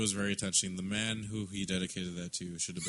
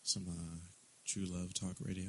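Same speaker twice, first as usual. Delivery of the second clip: lower, softer sultry radio host; late-night radio voice; 4 semitones lower, 9 dB quieter than usual. Pretend. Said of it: "there we go".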